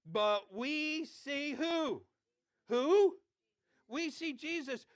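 slow, loud prompt to congregation; preacher calling out to the congregation; call and response. Real, not acted. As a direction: draw the crowd into answering. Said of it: "read them with me".

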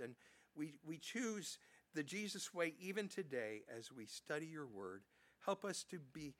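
a pastor opening the sermon with prayer. Acted. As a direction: pray it over the congregation, earnest and steady.

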